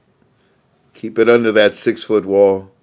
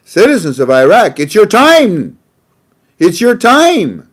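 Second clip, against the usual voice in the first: higher and louder, a booming president's declaration; grand presidential proclamation; 10 semitones higher, 8 dB louder than usual. Retold or imitated. The President addressing the citizens of Iraq, higher, louder, grand proclamation. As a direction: proclaim it like a president stirring a nation. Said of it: "The President ... America said".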